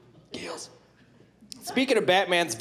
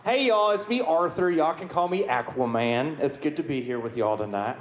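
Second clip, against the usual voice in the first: put-on folksy Southern accent, teasing the crowd. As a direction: play a good-ol'-boy Southern Aquaman. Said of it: "why do you have a southern accent?".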